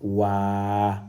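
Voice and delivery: "Wa" with the a held long; long vowel. Shown as a demonstration. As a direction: stretch the a in "Wa" evenly. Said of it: "sert de d'allongement à la voyelle A".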